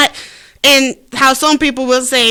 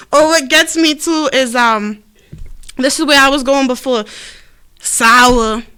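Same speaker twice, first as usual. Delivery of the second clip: ordinary delivery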